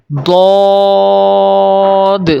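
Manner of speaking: دود stretched very long with a heavy d; drawn-out emphatic دود; thick Arabic d. Shown as a demonstration. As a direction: recite دود full and rounded on a steady note. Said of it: "موٹی آواز کے ساتھ پڑھنا ہے".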